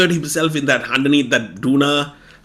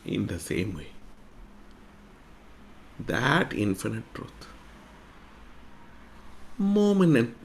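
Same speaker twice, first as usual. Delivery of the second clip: sermon reflection; 6 dB quieter than usual. Acted. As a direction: bring the lesson home gently. Sermon moral after story.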